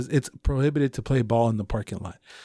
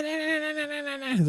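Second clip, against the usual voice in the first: mock neighbor; 13 semitones higher, 7 dB quieter than usual. Mocking neighbor continues.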